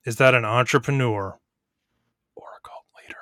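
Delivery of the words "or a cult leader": whisper. Acted. Host teasing the contestant.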